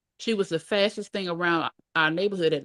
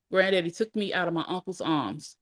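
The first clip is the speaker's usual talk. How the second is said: ordinary delivery, nothing marked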